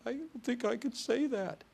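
high mock-tearful voice; crying wife imitation. Wife tells the preacher she cannot, imitated in high tearful voice. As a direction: whimper it high, as if welling up. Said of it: "she began to cry".

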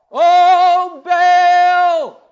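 loud, high wailing shout to Baal; priests crying out. Retold or imitated. Preacher mimics Baal priests calling, loud wailing shout. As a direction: bellow it up to a deaf idol. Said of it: "Gotta shout loud for Baal to hear you".

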